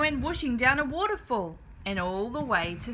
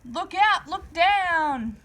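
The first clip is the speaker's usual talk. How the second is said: higher urgent call; yelling warning; 6 semitones higher, 3 dB louder, much slower than usual. A narrator's reading. Drip warning his sisters on the bridge, urgent yell. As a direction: shout the warning across the river. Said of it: "who yelled out".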